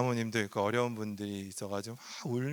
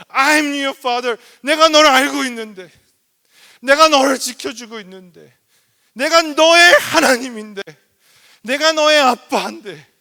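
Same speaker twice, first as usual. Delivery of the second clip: loud, high, passionate voice of God the father; God speaking; 14 semitones higher, 18 dB louder than usual. Acted. God reassuring his frightened children, in a loud high passionate cry. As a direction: proclaim it as a loving father calling out. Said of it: "하나님이 우리에게 말씀하시는".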